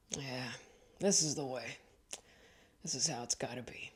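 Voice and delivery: soft, hushed contentment; blissed-out murmur; serene whisper. Retold or imitated. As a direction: whisper like you're at peace. Inner thought behind slow car.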